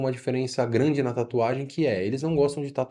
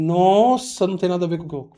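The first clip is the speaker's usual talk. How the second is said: amazed; 6 semitones higher, 5 dB louder than usual. Retold or imitated.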